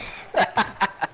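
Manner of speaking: high, breathy giggle; breathless laugh. Real, not acted. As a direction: laugh it off unvoiced. Laughing after joke.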